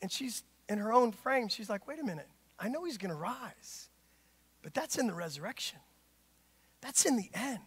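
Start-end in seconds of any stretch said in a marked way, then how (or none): 1.9-7.6 quiet protest. Acted. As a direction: gently push back under her breath. Martha's hushed correction.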